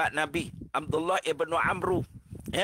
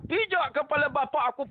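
angry retort; 8 semitones higher than usual. Retold or imitated. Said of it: "Saya kata".

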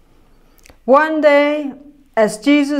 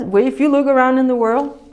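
usual speaking voice

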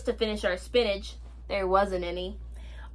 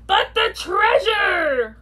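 high, loud, slow cry; pirate holler; 8 semitones higher, 5 dB louder, much slower than usual. A narrator's reading. Captain worries for treasure in storm, loud holler. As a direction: holler it, drawing out the words. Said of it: "hollered Braidbeard".

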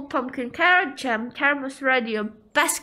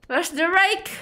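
ordinary talk

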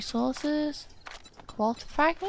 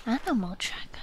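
soft whispered read-out; whispering a menu item while reading; 6 dB quieter, faster than usual. Real, not acted. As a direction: breathe it to yourself. Reading game menu to self.

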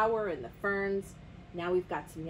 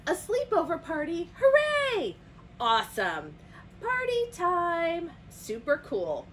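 high, eager cheers; excited friends; 8 semitones higher, 4 dB louder than usual. A narrator's reading. Friends receive Toucan's sleepover invitation, cheering excitedly. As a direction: voice a group thrilled by an invitation.

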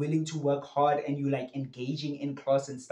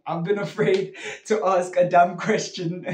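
amused confession with laughter breaking in; laughing; 2 semitones higher, 5 dB louder than usual. Real, not acted. Teacher admitting own fear of dumb questions to students, laughing. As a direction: laugh through the admission.

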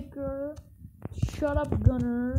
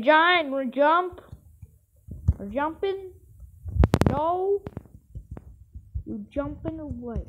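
normal talking voice

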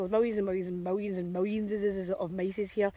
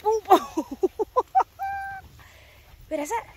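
ordinary delivery

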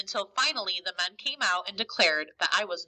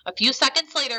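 usual speaking voice

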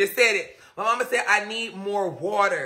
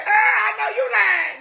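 much higher, loud, laughing and crying out; laughing shout; 13 semitones higher, 3 dB louder than usual. Retold or imitated. She retells the thumb talking back, laughing loudly. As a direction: yell it while cracking up.

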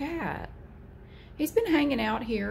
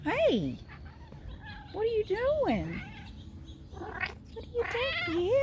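much higher cooing question; 13 semitones higher, 5 dB quieter than usual. Real, not acted. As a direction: coo at the cat. Cooing at the cat.

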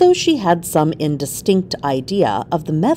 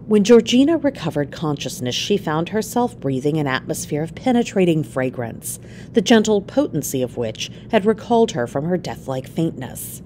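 usual speaking voice

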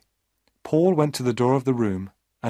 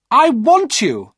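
much higher, louder, slow possessive woman's voice; young woman clinging to him; loud high desperate plea; Maria's demanding cry; 14 semitones higher, 9 dB louder, slower than usual. Acted.

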